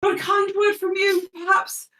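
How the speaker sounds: high, wheedling ask; Kev pleading. Acted. Kev asks the prince for kind words, high wheedling plea. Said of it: "He says".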